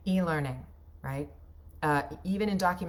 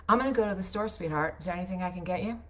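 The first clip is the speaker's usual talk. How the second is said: loose and quick, words run together; sloppy natural diction; much faster than usual. Shown as a demonstration. Wife to her husband, casual sloppy everyday read. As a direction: toss it off like real talk at home. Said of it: "Here's how I would say it instead".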